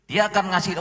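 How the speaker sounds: ordinary talk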